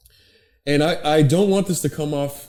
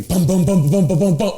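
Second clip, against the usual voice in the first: loud, higher rhythmic bum bum chant; mock chant; 4 semitones higher, 5 dB louder than usual. Describing Brody on a chair, loud mock chanting. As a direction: beat out a pumping chant like a hype man.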